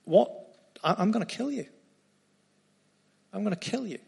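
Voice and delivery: a hint of a menacing queen; threatening. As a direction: voice Jezebel's threat, only half in earnest. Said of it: "Jezebel threatens Elijah and says".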